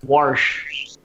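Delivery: Texas "Warsh" with an added r; strong Texas accent. Retold or imitated. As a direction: imitate a Texan saying "Warsh". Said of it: "a strong Texas accent".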